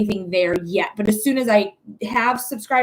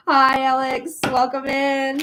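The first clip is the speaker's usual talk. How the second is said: usual speaking voice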